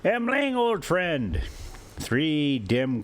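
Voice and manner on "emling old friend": calling out. A narrator's reading. Calling to his companion.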